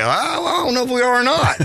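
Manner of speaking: a high, flustered hedge at the last second; nervous dodge. Retold or imitated. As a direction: caught off guard, backpedal nervously. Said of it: "And I look like".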